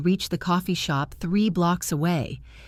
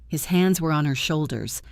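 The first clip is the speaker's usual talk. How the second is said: ordinary delivery, nothing marked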